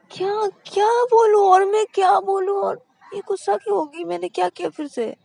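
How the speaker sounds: high, loud confused outcry; baffled girl. A narrator's reading. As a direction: cry out in helpless confusion. Shruti baffled shouting. (उलझन में ऊँची चीख)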